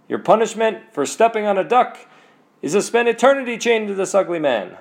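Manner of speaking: loud, high-pitched mock judgement; pronouncing a sentence. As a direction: hand down the verdict, pitched well up. Saint Peter pronouncing the punishment.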